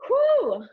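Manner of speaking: loud, high exhale of relief after the stretch; a real whew of effort, loud and high; relieved whew. Real, not acted. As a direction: blow it out like the burn just hit. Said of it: "I'm really feeling that".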